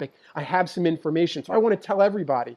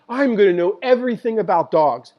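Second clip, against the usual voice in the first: mock know-it-all; 5 semitones higher, 6 dB louder than usual. Mocking know-it-all aspiration.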